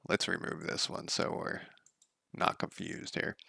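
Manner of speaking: breathy under-the-breath note to self; whispering to himself mid-task; whispered aside. Real, not acted. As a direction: drop to a whisper while fiddling. Muttered aside to himself while working.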